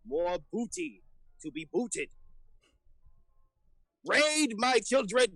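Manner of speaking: rising to a high rallying cry; rallying captain. Acted. Captain rallies ogre crew with new sword, high rallying cry. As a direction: whip the crew into a raid.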